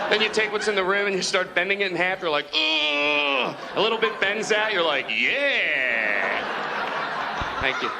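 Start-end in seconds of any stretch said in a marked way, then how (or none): 2.5-3.6 drawn-out mock effort groan; straining grunt. Bending the toothpaste tube in half, straining mock grunt. 5.1-7.9 long, drawn-out satisfied yeah; triumphant relief. Acted. User seeing toothpaste finally come out, triumphant relief.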